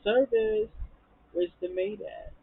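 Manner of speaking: high mock call for service; Toya. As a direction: sing out for the maid, imitating a diva. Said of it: "And then Toya was like".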